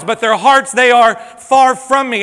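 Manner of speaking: ordinary delivery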